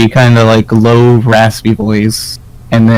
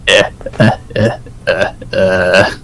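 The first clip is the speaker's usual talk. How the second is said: low, spaced-out "eh" chuckle; raspy villain chuckle; 3 semitones lower, 5 dB quieter than usual. Acted. Game master voicing the raspy voice as it begins to chuckle, low put-on chuckle. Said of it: "the raspy voice begins to chuckle".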